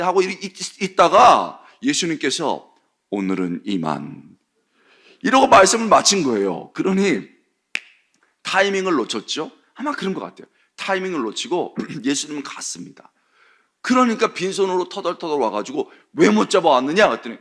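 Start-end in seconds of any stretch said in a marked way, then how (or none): 3.1-4.4 calm dismissal. Retold or imitated. Jesus closing his teaching. 16.1-17.1 scolding. Retold or imitated. Priests scolding the officers.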